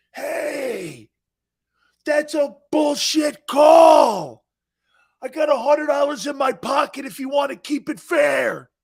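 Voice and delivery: hammed-up sideline heckler yelling; heckling shout. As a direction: bellow at the referee from the stands. Heckling ref at rugby game.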